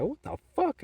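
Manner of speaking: high-pitched retold confrontation; mock-outraged, pitch leaping up; indignant outburst. Driver reacts to man hitting the car, indignant.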